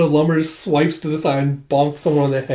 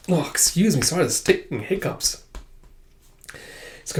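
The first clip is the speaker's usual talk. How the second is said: ordinary delivery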